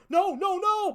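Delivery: high, loud panicked cry; mock terrified victim. Man with the garbage seeing the gun, mock panicked high cry. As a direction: squeal it like a cartoon victim. Victim screams before being shot.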